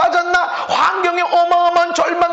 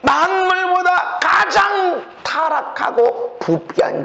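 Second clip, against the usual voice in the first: slow proclaimed shout; 4 semitones higher, slower than usual. Real, not acted.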